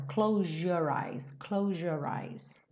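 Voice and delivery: "Close your eyes" with the Z and Y merging into a zh sound, kloʒ or aɪs; Z turned to J. Shown as a demonstration. Teacher showing the Z becoming a J sound, said softly. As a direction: blend "Close your" into a soft zh. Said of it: "esse som de Z acaba sendo modificado pra um som quase de J".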